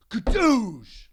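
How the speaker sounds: a loud, punchy "Kadoosh!"; loud sound effect. Retold or imitated. Arm wrestler pinning a small guy instantly, loud effect. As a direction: slam it like an instant win.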